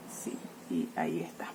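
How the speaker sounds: breathy whisper to herself; whispered aside. Real, not acted. Teacher finishing the board, whispering to herself. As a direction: murmur it under your breath as you finish writing.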